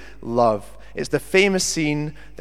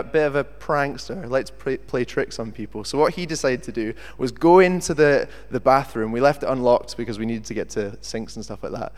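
normal talking voice

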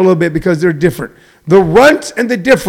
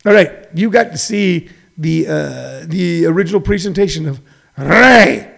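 usual speaking voice

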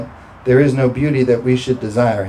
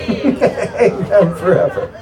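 high, slow amen through laughter; laughing; 6 semitones higher, much slower than usual. Real, not acted. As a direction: let the laugh carry into the words. Laughing after own redneck joke.

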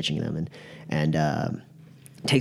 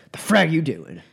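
annoyed snap; 8 semitones higher, 4 dB louder than usual. Acted. Doc snaps at intruder.

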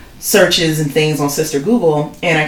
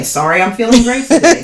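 exasperated mock apology; 7 semitones higher, 5 dB louder than usual. Real, not acted. Mocking endless apologizing.

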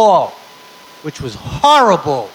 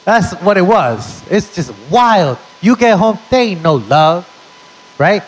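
ordinary delivery

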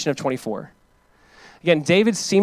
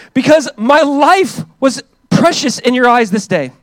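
fervent scripture reading; 2 semitones higher, 6 dB louder than usual. Acted. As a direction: read it out like from the pulpit. Reads David's reply aloud.

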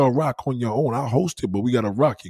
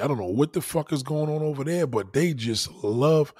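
ordinary talk, nothing marked

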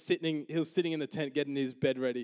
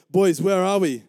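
loud, higher retold shout; calling out; 5 semitones higher, 12 dB louder than usual. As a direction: call across to the boys, imitated. Caller shouts over to his friends.

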